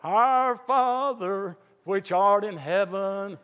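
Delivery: slow, solemn recital of the Lord's Prayer; formal recited prayer. Retold or imitated. Preacher mocking a calm, formal prayer in a crisis. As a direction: drag it out like rote churchy praying. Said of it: "you don't go".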